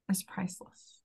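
soft tearful whisper. Real, not acted. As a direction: breathe the words out, moved. Emotional peak, whispered.

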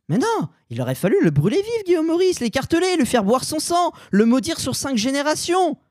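mock outrage. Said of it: "Ceci est de l'humour d'exagération".

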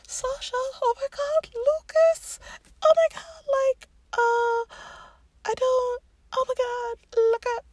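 very high, breathless gushing; overexcited fan screaming over a book; fangirl squeal. Retold or imitated. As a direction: squeal it like you can't contain yourself. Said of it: "I was just like".

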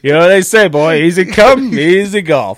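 put-on slow, loud, high old codger; old grandpa voice. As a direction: drawl it out like a folksy grandpa. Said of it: "something your grandpa would say".

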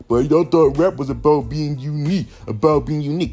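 mocking voice. Mocking fans' complaint.

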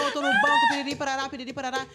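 laughing loudly and high. Real, not acted. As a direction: laugh through the words. Laughing while telling church gossip.

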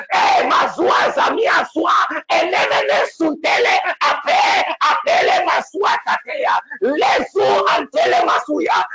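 loud, high ecstatic tongues; shouted tongues. Real, not acted. Prophet praying in tongues before the congregation, shouted ecstatic tongues. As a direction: pray it out in tongues.

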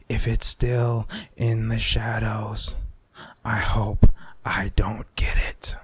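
slow, hushed, creepy whisper; spooky whisper. Acted. To listeners about the lurking virus, eerie whisper. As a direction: whisper it like a ghost story.

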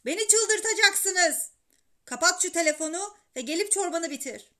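father at the end of his patience; exasperated shouting. A narrator's reading. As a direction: order the boy off the phone. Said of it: "diye bağırdı babam".

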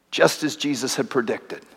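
ordinary delivery

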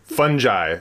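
"Fungi" tried out as FUN-jai. Shown as a demonstration. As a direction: repeat "Fungi" with a j sound in place of the hard g. Host repeats guest's pronunciation.

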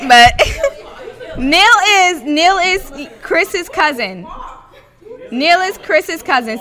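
laughing through the words; giggling while speaking. Real, not acted. Laughing while explaining Neil.

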